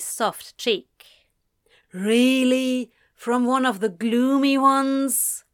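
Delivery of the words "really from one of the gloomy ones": loud, higher-pitched groan; drawn-out, protesting groan; groaned objection. A narrator's reading. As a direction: groan it out in disbelief. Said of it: "Anthony groaned".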